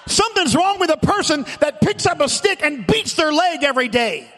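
rising, shouted sermon cadence; preaching. Acted. Pastor preaching loudly to the church about its body. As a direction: preach it hard to the congregation.

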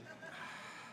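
a long unvoiced sigh. Real, not acted. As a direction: breathe out a weary sigh. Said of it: "that one was just for me".

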